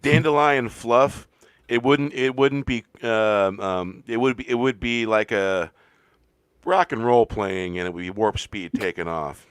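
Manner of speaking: loud, slow pilot announcement over a speaker. Acted. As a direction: announce it like an airline captain. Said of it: "You do sound like a pilot".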